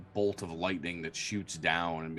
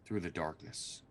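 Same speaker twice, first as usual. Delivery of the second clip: ordinary delivery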